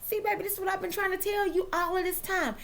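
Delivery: lightly voiced man telling his girl he was right; imitating a boyfriend. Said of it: "fellas, what you're going to say is".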